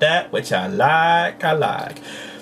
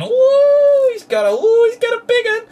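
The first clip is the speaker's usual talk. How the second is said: high loud gleeful ooh; excited squeal; 6 dB louder than usual. Real, not acted. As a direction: gush over the big sword. Excited over toy sword.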